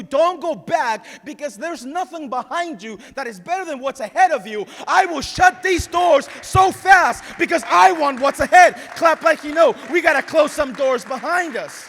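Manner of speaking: full-force sermon shout. Real, not acted. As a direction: belt it out to the whole church. Preacher at full climax.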